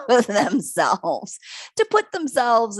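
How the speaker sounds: laughing. Real, not acted. Laughing at Aries traits.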